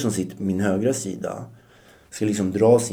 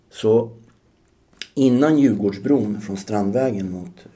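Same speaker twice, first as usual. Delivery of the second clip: normal talking voice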